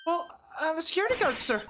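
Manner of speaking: high, fast eager guard voice; Gumshoe's twin sister. Acted. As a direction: rattle it off like a flustered rookie guard. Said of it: "Gumshoe's, like, secret, like, long-lost twin sister".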